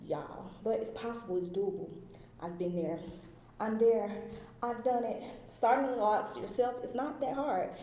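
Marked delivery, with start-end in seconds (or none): none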